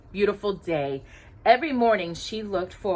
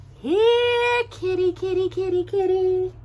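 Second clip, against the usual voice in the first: much higher, sing-song coaxing to a cat; soft coaxing call; 12 semitones higher, slower than usual. A narrator's reading. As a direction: coax the cat out gently from the doorway. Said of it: "Cinderella called softly".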